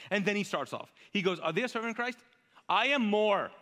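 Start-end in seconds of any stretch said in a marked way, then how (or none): 1.4-3.5 indignant boast, higher and louder; Paul's rant. Acted. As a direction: rant like an apostle fed up with comparisons. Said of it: "Paul on a rant".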